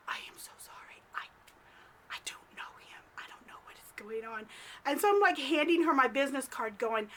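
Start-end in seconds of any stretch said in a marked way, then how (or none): 0.1-4.4 hushed, unvoiced-sounding aside; whispered apology. Narrator secretly apologizes to the lady, whispering.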